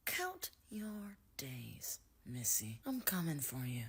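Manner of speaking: slow, low whisper; whispered, drawn-out mock menace; mock menacing threat. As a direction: hush it right down into a villain's threat.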